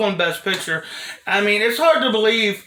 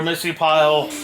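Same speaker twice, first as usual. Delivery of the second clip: usual speaking voice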